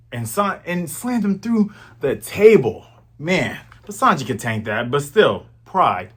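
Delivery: ordinary talk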